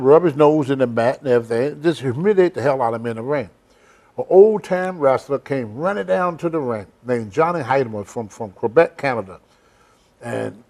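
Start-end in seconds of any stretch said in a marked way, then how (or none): none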